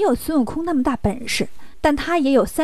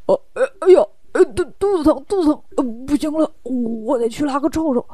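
faked bellyache. Pigsy lies to escape the fight, fake pained groaning. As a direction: badly feign stomach cramps, stammering. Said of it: "撒了个谎".

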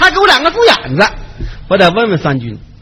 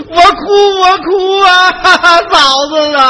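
stagey wailing sob, drawn out; put-on crying; much slower than usual. Man wailing to his sister-in-law to win troops, fake crying. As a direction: break into a hammed-up wail for the sister-in-law. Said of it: "我还得哭".

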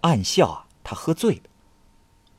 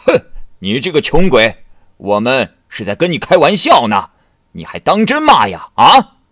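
louder mocking snarl that rises to a shout; angry retort; 4 semitones higher, 8 dB louder than usual. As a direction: hit back at the poor scholar. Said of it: "公役生气地说".

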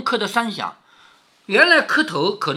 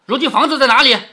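raised, louder urgent question; hurried questioning; 5 semitones higher, 7 dB louder, faster than usual. Acted. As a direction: jump in and demand the answer. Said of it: "凤姐忙问". (急切地高声追问)

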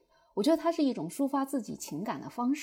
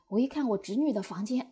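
ordinary delivery

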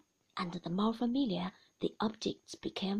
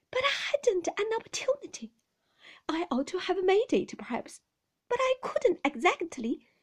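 high, halting excuses; flustered nurse; 8 semitones higher, 4 dB louder than usual. A narrator's reading. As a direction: justify yourself in an agitated, pitched-up voice.

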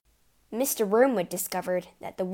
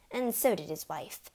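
plain reading voice

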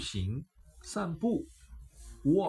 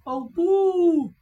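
higher, louder cry of alarm; cry of dismay; 13 semitones higher, 8 dB louder than usual. A narrator's reading. Amber seeing the dog jump at a passer-by, loud cry. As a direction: gasp it out as the dog leaps. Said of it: "艾博尔叫道".

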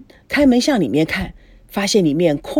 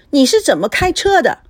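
raised, higher-pitched reproach; very displeased; 7 semitones higher, 4 dB louder than usual. A narrator's reading. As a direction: snap crossly at a driver. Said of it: "孙娜非常不高兴".

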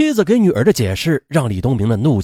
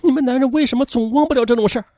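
tearful outburst; 10 semitones higher than usual. A narrator's reading. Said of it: "便哭泣着说".

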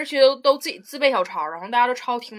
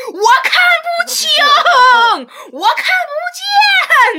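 much higher, louder, very slow whiny wail; imitating a whiny classmate in the exam hall; mock whining classmate; 11 dB louder, much slower than usual. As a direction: wail like a desperate cheater. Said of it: "就一直在喊".

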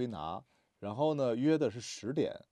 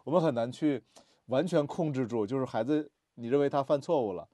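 normal talking voice

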